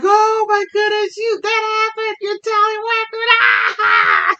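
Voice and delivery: very high, loud shriek breaking into laughter; imitated hysterical laughing. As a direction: mimic a friend helpless with laughter. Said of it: "rolling, laughing".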